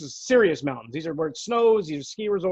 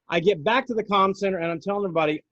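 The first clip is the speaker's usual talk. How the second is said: ordinary talk, nothing marked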